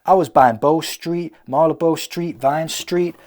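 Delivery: a touch of a loud American movie hero; lightly American hero voiceover; American accent. Pretend. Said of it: "Why am I doing an American accent?".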